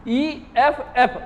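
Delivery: ईएफ़एफ़ said slowly as separate letters; slow "e-f-f" for the students; spelling out ef. Shown as a demonstration. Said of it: "इसका उच्चारण हो गया एफ".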